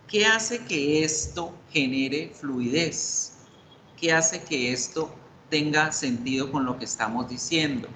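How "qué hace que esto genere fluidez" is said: ordinary talk, nothing marked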